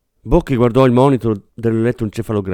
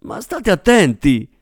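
higher, slow annoyed warning; irritated; 7 semitones higher, slower than usual. A narrator's reading. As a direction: tell them off, rattled. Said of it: "si innervosì l'attrice". (innervosita, tono più acuto)

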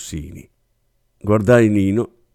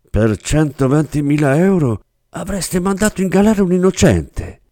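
raised, outraged reproach, slowing on the end; indignant; 9 semitones higher, 3 dB louder than usual. Acted. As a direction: hurl the accusation in disbelief. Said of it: "si indignò Rossini". (rimprovero indignato)

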